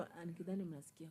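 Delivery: ordinary delivery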